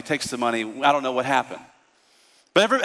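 ordinary talk

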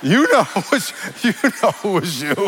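high teasing start breaking into laughter; laughing accusation. Real, not acted. Preacher catching out his congregation, laughing accusation.

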